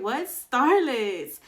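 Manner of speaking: slow, loud puzzled question; mock puzzled; confused what Starlets. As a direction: draw it out confused. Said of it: "I'm like".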